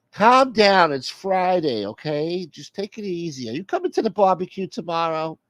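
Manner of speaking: high-pitched voice calming someone down; exasperated soother, placating. Acted. Plays the one calming down a caller.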